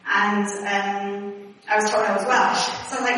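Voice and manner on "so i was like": ordinary delivery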